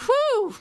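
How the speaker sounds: high, loud relieved whew. Real, not acted. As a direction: blow out in relief. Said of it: "after the sneezes".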